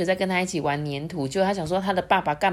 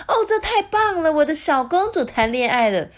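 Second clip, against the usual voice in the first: very high gushing delight; thrilled queen; 11 semitones higher, 4 dB louder than usual. Acted. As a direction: squeal over the news. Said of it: "皇后就说".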